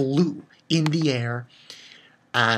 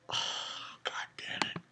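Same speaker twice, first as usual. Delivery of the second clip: hushed, stunned whisper; whispered curse; 14 dB quieter than usual. Retold or imitated. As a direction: mutter it under your breath in shock. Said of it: "I was like".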